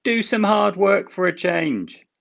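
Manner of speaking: high, loud teasing engine voice; little engine mocking the big one; cheeky teasing. A narrator's reading. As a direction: taunt him playfully from up high. Thomas teasing Gordon.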